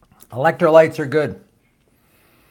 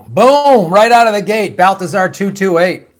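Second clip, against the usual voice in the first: loud, high burst of hype; excited call-out; 4 semitones higher, 7 dB louder than usual. Real, not acted. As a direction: announce it like a hype man.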